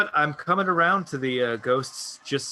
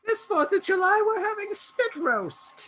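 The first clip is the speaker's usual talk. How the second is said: squeaky high voice. Pretend. Mock ghost announcement.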